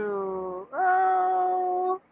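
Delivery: high, drawn-out coo; melting high "oh" at something cute; cooing aww. Real, not acted.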